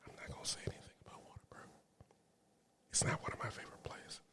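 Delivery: breathy whisper, like a secret. Real, not acted. Vlogger whispering her dig at Whataburger to viewers. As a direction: whisper the jab as if someone might overhear.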